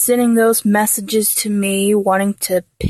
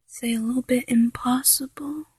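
whispered; 3 semitones higher, 8 dB quieter, faster than usual. Acted. Mocking his impossible plan.

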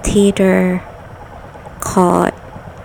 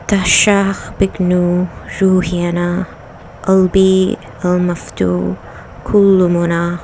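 reading aloud; much faster than usual. Acted. Reads an Arabic passage.

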